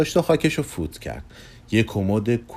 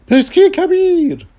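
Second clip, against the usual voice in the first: much higher, louder showman's voice; grand magician; 8 dB louder than usual. Acted. Persky calling Kugelmass, loud and high. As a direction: announce yourself like a grand conjurer.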